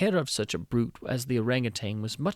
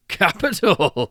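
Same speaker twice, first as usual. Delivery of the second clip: loud, high, drawn-out roar; 7 semitones higher, 10 dB louder, slower than usual. Acted. King and ministers roar approval, loud and slow. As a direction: bellow it with drunken delight. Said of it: "roared in chorus".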